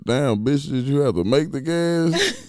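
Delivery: high, loud, fed-up yelling; mock yell on the phone. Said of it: "I said, I called the phone".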